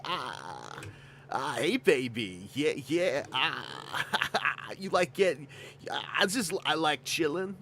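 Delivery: slow, drawling lazy-guy voice with a breathy sigh; lazy stoner voice. Pretend. Streamer voices his lazy character chilling. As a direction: play a half-asleep slacker flirting.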